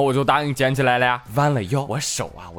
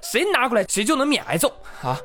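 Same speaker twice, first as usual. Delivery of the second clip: high, scolding mother's threat; hint of a stern mom lining the kids up; angry mom; 11 semitones higher, faster than usual. (略带凶妈妈的口吻)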